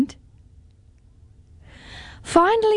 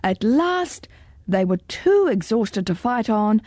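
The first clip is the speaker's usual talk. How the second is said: plain reading voice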